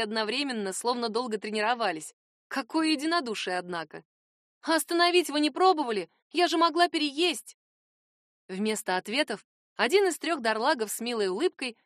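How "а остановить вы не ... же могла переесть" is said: high, loud, outraged protest; indignant exclamation. A narrator's reading. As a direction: cry out in dismay. Heroine protests at friends.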